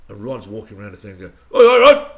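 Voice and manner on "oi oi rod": loud cheeky terrace yell, pitched high; shouted greeting. Retold or imitated. Fans yelling at Rod as he walks past, loud cheeky shout. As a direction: holler at the star from the crowd.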